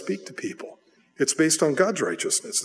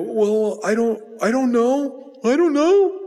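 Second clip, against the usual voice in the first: put-on scared little boy; 8 semitones higher, slower than usual. Preacher imitating himself as a caught child, high and scared. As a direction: squeak it out like a caught second grader. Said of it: "And I go".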